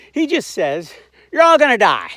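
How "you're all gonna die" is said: higher, louder doomsday shout; hammed-up prophet of doom; mock doom cry. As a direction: bellow it like a street prophet of doom. Mocking Jonah's blunt sermon.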